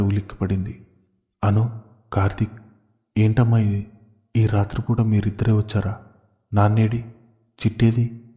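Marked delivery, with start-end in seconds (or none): none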